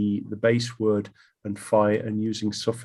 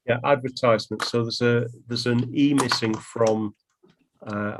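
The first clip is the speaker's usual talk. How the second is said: ordinary delivery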